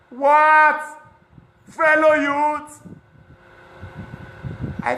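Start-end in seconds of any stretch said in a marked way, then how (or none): 0.1-2.8 high, slow, stretched shout of disbelief; incredulous outcry. Real, not acted. Asking the crowd, in loud disbelief. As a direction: cry it out like you can't believe your ears. Outraged at youth's priorities.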